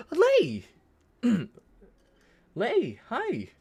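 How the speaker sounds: squealed high, loud greeting; light up and call out her name; thrilled to see a friend in chat; excited greeting. Real, not acted. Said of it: "I got really excited".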